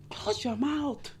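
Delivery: slow, soft, deliberate order; slow firm command. Acted. Vlogger telling women to hush, slow and soft. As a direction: spell it out slowly.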